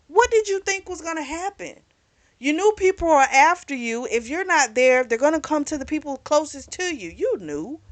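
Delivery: lightly reenacted telling him off, pitch up; mock heated scolding of a friend; exasperated retort. Retelling her own angry reply.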